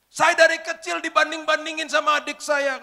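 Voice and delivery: high, loud and slow, tearful; imitating a sobbing young woman; crying complaint. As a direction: wail it out through tears. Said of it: "Sambil nangis-nangis".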